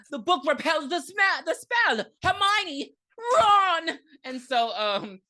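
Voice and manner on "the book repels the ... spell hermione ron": very high, hammed-up British cry; mock British accent. Recapper mimics the character calling to friends, mock British accent. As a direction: call out in a squeaky British parody. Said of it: "Just be British. Everyone else is".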